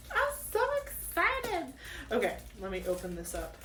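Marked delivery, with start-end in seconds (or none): 0.1-1.7 tiny, very high, soft squeal; excited squeal. Real, not acted. Vlogger about to open her kit, softly squealing with excitement. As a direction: squeak it out slowly, barely containing it.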